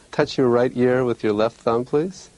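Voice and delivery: ordinary talk, nothing marked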